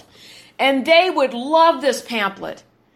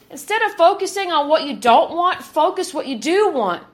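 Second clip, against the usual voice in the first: ordinary talk, nothing marked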